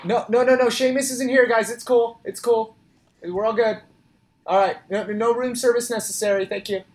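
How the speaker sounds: mock-anxious call through the hotel wall; calling out reassurance. Calls out to hotel neighbours.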